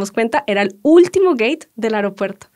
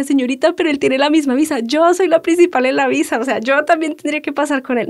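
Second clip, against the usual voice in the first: higher and louder, urgently pleading with the clerk; anxious pleading; 5 semitones higher, 4 dB louder than usual. Retold or imitated. Nervous traveller begging the airline clerk, higher and louder. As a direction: insist your passport should pass, rising in pitch.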